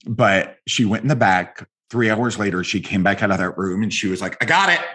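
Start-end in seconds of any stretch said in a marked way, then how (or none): 4.4-4.8 retold triumph, pitched up high; triumphant exclaim. Lactation consultant emerging after three hours, triumphant high exclaim. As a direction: announce the win with a high burst of relief.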